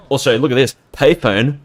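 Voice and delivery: excited. Real, not acted. Streamer spotting a payphone in game, excited. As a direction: point it out with a burst of delight.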